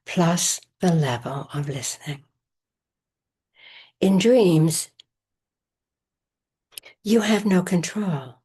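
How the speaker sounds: measured meditation voice with long pauses; guided meditation. Acted. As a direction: guide listeners into rest, phrase by phrase, leaving space between. Leads a recorded Yoga Nidra session.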